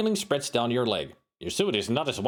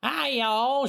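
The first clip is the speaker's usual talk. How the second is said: much higher Southern belle voice; Southern drawl; 13 semitones higher than usual. Acted. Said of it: "she replies in a charming drawl".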